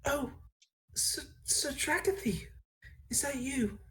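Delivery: high, soft, hesitant barmaid voice; high voice stumbling over the name; emotional barmaid. Acted. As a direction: falter in high-pitched disbelief at seeing someone. Barmaid's tearful recognition in game.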